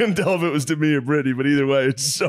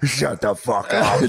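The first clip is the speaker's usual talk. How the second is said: slow mock snarl; slower than usual. Friend imitates nasty patient.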